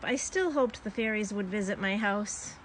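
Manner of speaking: ordinary delivery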